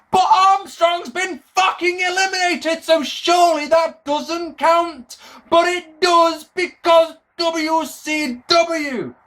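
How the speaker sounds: high-pitched incredulous protest; mock outrage. Wrestling fan protesting a disqualification ruling, mock outrage.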